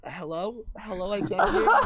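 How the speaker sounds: lightly imitated phone greeting; puzzled hello, rising as if the line is bad; prank voicemail greeting. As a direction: act out a fake bad connection. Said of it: "your voicemail was like".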